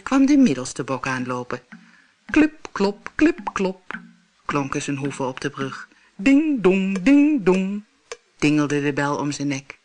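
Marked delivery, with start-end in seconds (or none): 2.3-4.0 hoof sounds. A narrator's reading. Middle goat hooves. 6.1-7.8 louder ding dong; chiming bell; bell sounds. A narrator's reading. Middle goat's bell.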